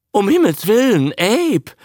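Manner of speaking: high, loud cry of amazement; shrieking Lee. A narrator's reading. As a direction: cry out, suddenly thrilled. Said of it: "schrie Fräulein Lee auf".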